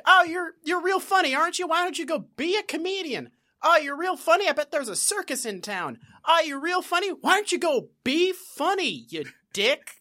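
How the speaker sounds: high, loud indignant comeback; a cop sneering at a prank; Barney Fife taunt. Retold or imitated. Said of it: "They're just like".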